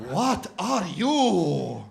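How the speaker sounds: very slow, loud, swelling question; insistent shopkeeper. Retold or imitated. Doner guy presses customer on origins, slow and insistent. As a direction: boom it out, every word hugely stretched. Same question repeated much bigger.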